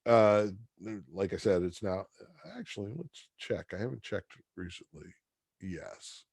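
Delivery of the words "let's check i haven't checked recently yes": usual speaking voice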